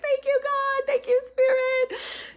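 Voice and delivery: high crying voice of gratitude; choked-up thank you, pitched high; tearful thanks. Retold or imitated. Thanking God at the bookstore, tearful high voice. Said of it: "I remember just crying".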